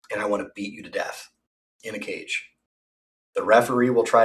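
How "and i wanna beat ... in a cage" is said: fast and much softer; quiet menace. Real, not acted. Threatening T-Cast Madness with a hushed, fast menace. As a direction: rush the threat out under the breath.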